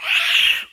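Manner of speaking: loud, rushing breath of wind; imitated storm roar. Man recalling last night's storm, loud wind noise. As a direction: whoosh like a howling gale. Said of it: "the wind and everything was".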